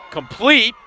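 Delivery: sudden yell as the pass gets picked off; excited call. Real, not acted. Play-by-play man calling the interception: rising excited shout.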